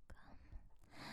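barely audible breathy whisper; faint whisper. Acted. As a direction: breathe the word out. Countdown reaching its cue word.

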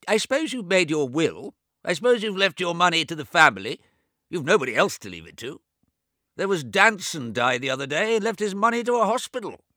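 put-on high, loud old man raising his voice over deafness; a fussy deaf old man talking too loud; deaf old brother. Deaf old James to his bedridden twin, high and loud. James prying about the will.

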